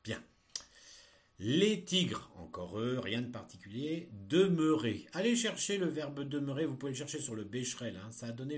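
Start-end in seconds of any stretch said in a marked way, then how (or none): 1.4-2.3 slow, loud and distinct dictation; dictating. Shown as a demonstration. Teacher dictating text to pupils. 4.3-5.1 slow, loud and distinct dictation; dictating. Shown as a demonstration. Teacher dictating text to pupils.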